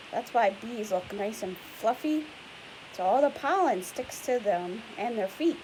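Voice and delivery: ordinary talk, nothing marked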